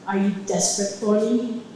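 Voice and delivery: a hint of a deep, sinister man; lightly voiced menacing alter ego; sinister man's voice. Girlfriend retells the alter ego's sinister come-on, lightly voiced. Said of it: "a grown man with a deep, sinister voice".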